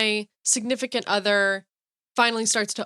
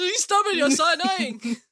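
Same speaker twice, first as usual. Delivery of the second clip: much higher, playful outburst; mock annoyed cartoon voice; 6 semitones higher than usual. Co-host answers back in cartoon quarrel, mock annoyed. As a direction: fire back in a high cartoon whine.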